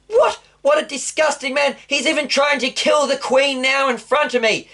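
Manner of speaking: the king recoiling in indignant horror; shocked outrage. Acted. King seeing Haman lunge at the queen, high outraged cry.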